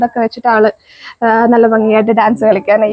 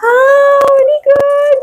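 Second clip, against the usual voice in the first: delighted praise; 12 semitones higher, much slower than usual. Real, not acted. Mother praising her toddler for finding things, delighted. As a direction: lift the praise up high.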